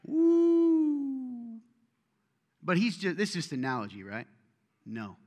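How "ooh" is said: high, loud spooky "ooh"; a drawn-out ghostly ooh for effect; preacher's playful spooky ooh; mock ominous ooh. Reacting to hellfire threat.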